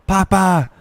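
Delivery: hammed-up cultist yelling at the player; mock screaming. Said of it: "starts screaming".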